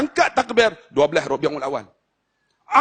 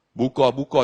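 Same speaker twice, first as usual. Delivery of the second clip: ordinary talk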